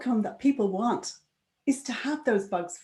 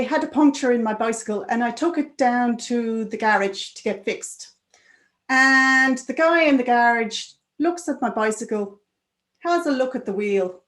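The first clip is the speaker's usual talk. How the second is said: an Irish trainer's lilt, raised and louder; Irish accent; 4 semitones higher, 5 dB louder than usual. Retold or imitated. Trainer quizzing the tester about bikes, in an Irish accent. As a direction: retell the Irish trainer's question in his accent. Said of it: "in his best Irish accent".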